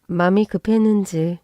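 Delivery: ordinary delivery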